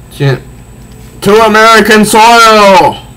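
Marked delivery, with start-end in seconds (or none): 1.2-3.0 high, loud heroic shout; hammed-up heroine battle yell; battle cry. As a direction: hurl him over with a war cry. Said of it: "she says".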